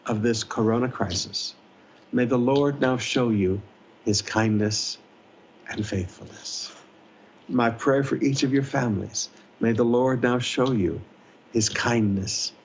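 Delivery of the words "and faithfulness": hushed. Real, not acted. Prayer trailing to a hush.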